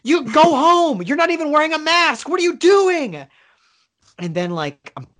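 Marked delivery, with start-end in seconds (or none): 0.0-3.3 high, loud furious yell; screaming at him. Retold or imitated. Bar patron screaming at a maskless cop. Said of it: "me and the bartender started screaming at him".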